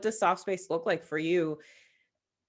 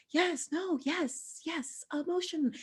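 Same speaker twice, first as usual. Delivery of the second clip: higher, hammed-up gushing approval; mock cheering; 9 semitones higher, 7 dB quieter, slower than usual. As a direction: gush over the tears in a put-on high voice.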